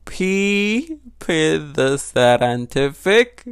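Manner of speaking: sounding out "scientific" piece by piece, slowly; struggling to pronounce. Real, not acted. Vlogger reading a definition, struggling to pronounce it. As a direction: stumble slowly through a hard word, cracking up. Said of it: "I don't know how I said that word".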